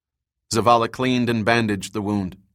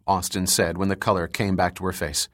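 usual speaking voice

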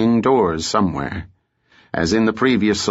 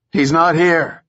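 put-on raised, higher old woman's cry; alarmed cry; 7 semitones higher, 3 dB louder than usual. A narrator's reading. Terrified old woman wards off a stranger, alarmed cry. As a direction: cry out in terror to ward him off. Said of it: "she cried".

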